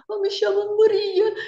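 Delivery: put-on high, strained Portuguese accent, voice barely coming out; a frightened girl faking a Portuguese accent that barely comes out; Portuguese accent, voice failing. Scared Brazilian girl to the pimp taxi driver, faking a Portuguese accent with her voice barely coming out. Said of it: "com sotaque de português, aí a voz nem saía".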